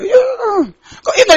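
high, slow mock cry; a sudden mock sob on the words; mock wail. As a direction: wail it out in pretend distress.

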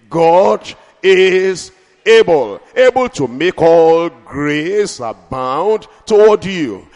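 slow, loud, stretched proclamation; drawn-out preaching. Acted. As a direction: lean on every word for the crowd. Preaching climax.

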